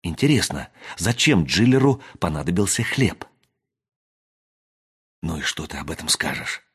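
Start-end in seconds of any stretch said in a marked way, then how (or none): 5.2-6.6 low, soft, near-whispered question; lightly acted whisper, pitched down; hushed whisper. A narrator's reading. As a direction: ask under your breath, as narrated dialogue. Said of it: "прошептал Ричард".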